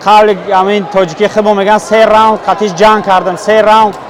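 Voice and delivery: loud lament. Acted. Fighter laments losing again.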